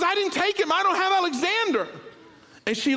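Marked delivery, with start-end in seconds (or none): 0.0-1.9 high, loud frantic denial; a wife realising nobody has the baby; panicked denial. Retold or imitated. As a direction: insist in alarm. Wife denies, rising panic.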